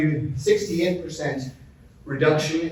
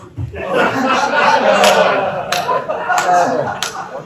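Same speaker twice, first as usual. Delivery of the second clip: hearty laugh; 5 semitones higher, 5 dB louder than usual. Real, not acted. Laughing at own joke.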